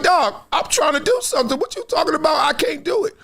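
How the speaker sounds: put-on a kid fed up and shouting back; frustrated little boy. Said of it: "the little boy like".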